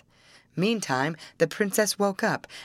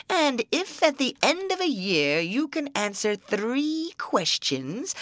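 troll voice. Acted. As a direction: growl it out like an old troll.

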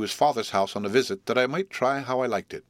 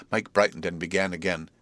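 ordinary delivery

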